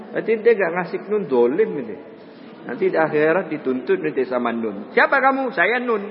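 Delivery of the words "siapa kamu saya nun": lightly acted, higher-pitched question and answer; mock interrogation. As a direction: play out a confrontation with the letter nun. Acted dialogue with the letter nun. (tanya jawab pura-pura dengan huruf nun)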